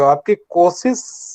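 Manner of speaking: normal talking voice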